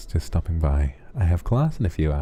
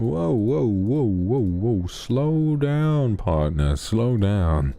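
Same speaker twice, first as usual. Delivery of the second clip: 1 cowboy whoa; 5 semitones higher, much slower than usual. Acted. Calming an excited viewer like horse.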